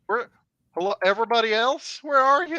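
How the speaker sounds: high, loud calling for the others. Acted. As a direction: shout out to find the group. Calling out in the dark.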